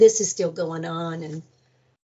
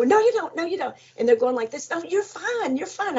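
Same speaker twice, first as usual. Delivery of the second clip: nurses brushing off; 8 semitones higher than usual. Retold or imitated. Nurses brushing off her fear.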